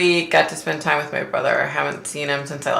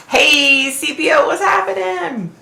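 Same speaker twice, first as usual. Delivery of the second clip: high cheery greeting; 12 semitones higher than usual. Real, not acted. Greeting a viewer joining.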